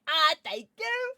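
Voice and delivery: high, bright thank-you chirp; cutesy thank you. Shown as a demonstration. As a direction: squeal a sweet thank you. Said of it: "make sure you say".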